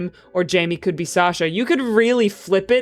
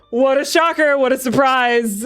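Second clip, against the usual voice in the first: loud, dripping sarcasm; sarcastic surprise; 4 semitones higher, 3 dB louder than usual. Pretend. To viewers about an obvious pairing, sarcastic mock shock. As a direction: pretend to be shocked.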